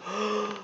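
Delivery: quick soft high in-breath; sharp gasp. Acted. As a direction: gasp in surprise. Before bear's cry.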